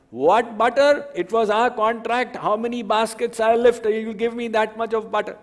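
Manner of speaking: high, loud teasing milkmaid voice; teasing gopi. Acted. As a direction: play the cheeky gopi, feigning innocence.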